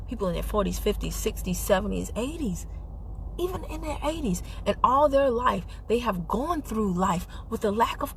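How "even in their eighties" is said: normal talking voice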